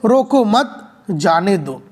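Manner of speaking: रोको मत together, long pause, then falling जाने दो; pause after मत. Shown as a demonstration. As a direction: slowly, break clearly after मत. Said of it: "और दूसरा मित्रों शब्द है". (मत के बाद लंबा विराम, फिर जाने दो)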